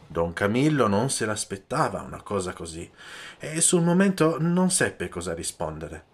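usual speaking voice

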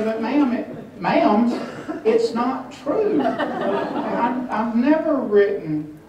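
high-pitched mock protest to a lady; pleading protest. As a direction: plead innocence, playing it for laughs. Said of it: "And then I would say".

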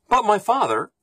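high, sugary coaxing tone; sweetly. Acted. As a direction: sweeten it to charm her father. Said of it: "Manyara said sweetly".

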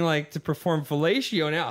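usual speaking voice

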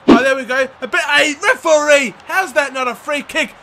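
heated disbelief at the decision; indignant protest. Real, not acted. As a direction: call out the injustice. Protesting to the referee.

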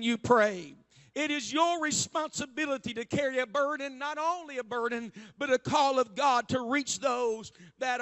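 loud, rising sermon exhortation; preaching at full force. Real, not acted. Preacher urging the church to reach their families, loud and fervent. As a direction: press the call on the congregation.

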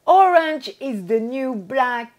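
slow, loud showy English accent; mock English accent. Shown as a demonstration. Announcing a series title to viewers, mock English accent. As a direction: put on a proud English accent, drawn out. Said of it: "avec mon super accent anglais".

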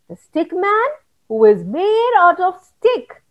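ordinary delivery

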